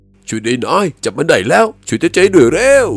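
high, loud cries for help; noisy high-pitched yelling, words stretched; clamorous shouting. A narrator's reading. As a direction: holler frantically mid-struggle. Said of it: "เสียงตะเจยโวกเวกโวยวายดังลั่น".

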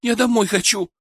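put-on high, tearful girl's voice; nearly crying. A narrator's reading. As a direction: ask in fright, on the verge of tears. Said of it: "чуть ли не плача спросила Даша".

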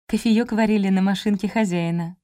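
usual speaking voice

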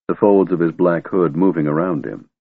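ordinary delivery, nothing marked

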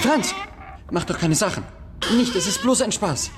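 desperate to stop him leaving, forcing a laugh; panicked pleading. Acted. Boy panics as bully leaves.

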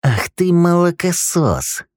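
high, slow seething hiss; hissed insult. A narrator's reading. Dudley hisses at young Rick for calling the guard, high and slow. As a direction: spit the insult slowly. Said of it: "прошипел Дадли".

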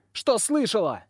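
put-on high, defiant girl's retort; cheeky high-pitched comeback. A narrator's reading. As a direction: throw it back insolently, pitched high. Girl defiantly answers the mistress.

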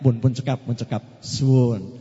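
a hint of a shy polite wife; polite pretend refusal. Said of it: "pura-puranya".

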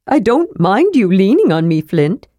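a brisk, friendly kender offering help; kender Tass voice. Acted.

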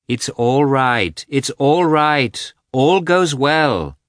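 loud, high, drawn-out reassuring call; calling after someone. A narrator's reading. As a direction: stretch each phrase so it carries to someone leaving. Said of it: "Dolly called after him".

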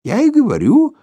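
put-on high, loud, hurt old man; offended old man. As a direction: insist like a wounded old man. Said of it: "обиделся старичок". (обиженный старичок)

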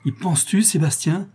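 high-pitched, rising appalled question; shocked outcry. A narrator's reading. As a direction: protest in alarm, voice lifted high. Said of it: "s'écria Isabelle".

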